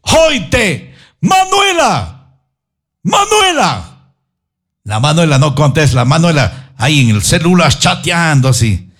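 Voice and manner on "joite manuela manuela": mock shout, pitched way up and drawn out; hollering a name across the house, high and long; calling out. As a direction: Marta Julia calling her daughter, high shouted call. Marta Julia calling her daughter.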